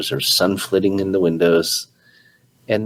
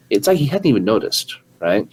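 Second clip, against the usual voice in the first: normal talking voice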